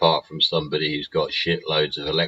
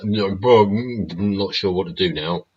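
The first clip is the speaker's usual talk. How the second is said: a lightly voiced dog, higher and louder; voicing the dog; 3 semitones higher, 5 dB louder than usual. Owner voicing his dachshund on a walk, lightly voiced and puzzled. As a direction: speak for the puzzled dog with a treat in his mouth. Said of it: "and he's like".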